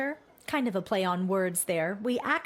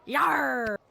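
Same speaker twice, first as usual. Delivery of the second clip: pirate voice; 4 semitones higher than usual. Acted. Pirate character talking.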